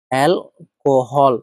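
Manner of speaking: Alcohol stretched very slowly, syllable by syllable; teacher dictating alcohol at a crawl; slow, spelled-out word. Shown as a demonstration. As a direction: draw out alcohol slowly so students catch every sound.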